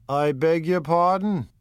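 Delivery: un boss di strada che racconta, alzando la voce; Wolfsheim. Acted. Wolfsheim apologises.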